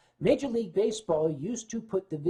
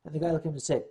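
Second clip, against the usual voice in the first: ordinary talk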